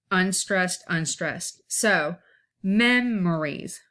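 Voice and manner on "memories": MEMORIES with MEM stretched and strong, the rest light; slow MEM-uh-reez, weight on the first syllable; dactyl stress. Shown as a demonstration. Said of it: "it is stressed".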